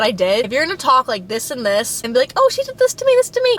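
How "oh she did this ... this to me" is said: high, whiny mock voice, slightly louder; sing-song mimicry of a tattletale; mocking whiny gossip. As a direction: parody someone complaining behind her back. Mocking someone who gossips about her.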